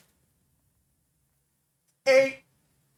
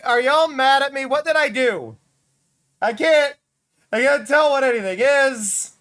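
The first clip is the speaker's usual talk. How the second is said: confused, raised voice; 6 semitones higher, 4 dB louder than usual. Real, not acted. Confused by glitchy game.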